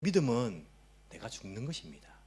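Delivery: usual speaking voice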